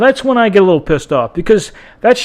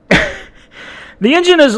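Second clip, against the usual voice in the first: laughing; 10 semitones higher, much slower than usual. Real, not acted. Laughs mid-rant about bad engine.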